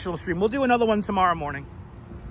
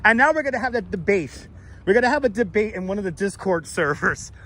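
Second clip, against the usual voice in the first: usual speaking voice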